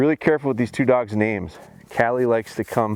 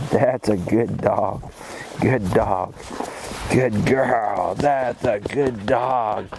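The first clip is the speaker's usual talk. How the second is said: slow praise; 3 semitones lower, much slower than usual. Real, not acted. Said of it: "you see how my voice and my excitement".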